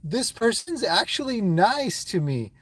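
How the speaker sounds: high, slow, breathless with laughter; giddy excitement. Retold or imitated. Streamer retells meeting a kind person, laughing giddily. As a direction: gush like you can't believe your luck. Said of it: "I get so excited".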